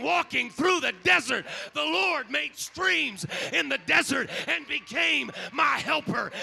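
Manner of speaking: loud, climbing preacher's cry, shouting out the praise; full-force preaching. Acted.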